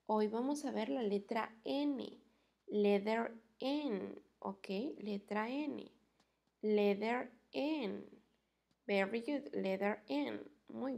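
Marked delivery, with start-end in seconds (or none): 2.7-4.2 slow, stretched "Letter N"; slow modelling. Shown as a demonstration. Teacher models the letter for pupils, slow and stretched. 6.6-8.0 slow, stretched "Letter N"; slow modelling. Shown as a demonstration. Teacher models the letter for pupils, slow and stretched.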